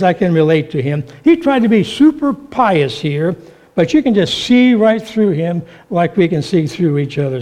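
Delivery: ordinary delivery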